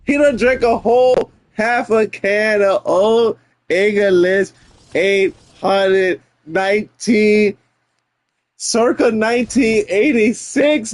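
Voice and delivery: slow, savoring each word. Real, not acted. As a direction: stretch it out like a fond memory. Savoring the old beer, drawn out.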